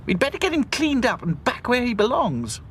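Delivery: high brisk doll voice; Dotty planning to return the puppy. A narrator's reading. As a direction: take charge. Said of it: "said Dotty".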